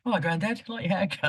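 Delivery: high-pitched imitation of a cheeky lad; mocking young lad. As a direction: tease in a put-on high voice. Mimicking young lads in dressing room.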